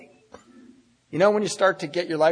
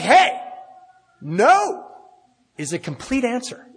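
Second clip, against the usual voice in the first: a higher, louder self-satisfied comeback; smug retort; 10 semitones higher, 3 dB louder, slower than usual. Retold or imitated. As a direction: deliver it like a winning line. His retort, lightly hammed.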